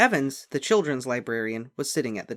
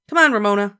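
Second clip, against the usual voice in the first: put-on higher, louder bossy older sister; Beezus, big sister; 8 semitones higher, 12 dB louder, faster than usual. A narrator's reading. Big sister calling Ramona, bossy and loud. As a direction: hurry the little sister along.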